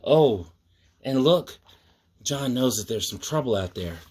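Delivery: plain reading voice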